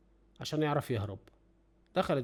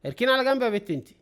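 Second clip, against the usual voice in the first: barked thief order; 8 semitones higher, 5 dB louder than usual. Retold or imitated. Voiced thief ordering pharmacist.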